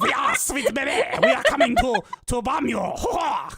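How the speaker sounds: high, giggly mock Canadian French; hammed-up French-Canadian villain breaking into laughter; Canadian French accent. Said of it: "probably said it in a Canadian French accent".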